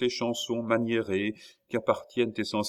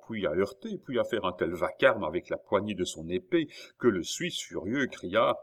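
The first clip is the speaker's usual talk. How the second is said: ordinary delivery, nothing marked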